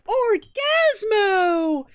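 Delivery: very high, loud, drawn-out hero cry; hammed-up triumphant shout, slowly stretched; mock heroic announcement. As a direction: belt out a superhero's entrance. First take of hero's entrance.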